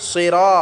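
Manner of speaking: "সিরা" with a thick emphatic s and rounded ra; "সিরা" as a heavy Arabic sirɔ; heavy saad. Shown as a demonstration. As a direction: make the s of "সিরা" full and heavy. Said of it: "মোটা করে উচ্চারণ করতে হবে".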